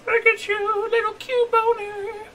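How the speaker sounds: very high, drawn-out cooing. Real, not acted. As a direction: coo at a tiny pet in a squeaky voice. Coos at the caught creature.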